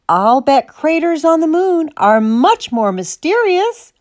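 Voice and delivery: high-pitched cow's grumble; sulky one-upping; grumbled. A narrator's reading. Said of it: "Martha grumbled".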